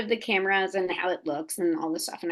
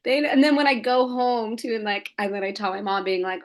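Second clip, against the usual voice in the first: ordinary delivery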